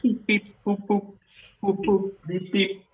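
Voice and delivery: robot beeps. Acted. Robot beeping again.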